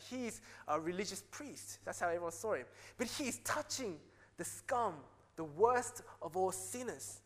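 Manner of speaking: ordinary talk, nothing marked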